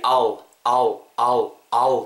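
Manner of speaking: "아우" as one loose syllable for I'll, dark l like oo; contracted I'll. Shown as a demonstration. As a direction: demonstrate "아우" in separate, repeated bursts. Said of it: "정도로 발음해주세요". ("I will"을 '아우'로 줄여 반복)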